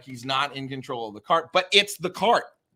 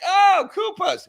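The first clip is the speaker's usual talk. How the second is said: much higher, slow, mocking "Oh, Koopas"; mock unimpressed viewer; 5 dB louder, slower than usual. Fan mocking lazy viewers to friends, high and slow. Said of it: "people are like".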